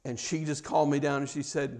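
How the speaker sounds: normal talking voice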